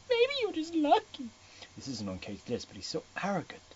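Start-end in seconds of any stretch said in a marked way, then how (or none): 0.1-1.3 put-on much higher, breathy girlish voice; flustered Ana. Ana nervously asking Gray about Kate, high-pitched. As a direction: squeak out the question nervously.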